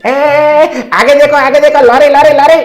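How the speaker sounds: high, loud warning yell; shout. Acted. Passenger warning driver of oncoming lorry, high yell. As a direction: scream the warning about the lorry. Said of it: "कहकर चिल्लाया".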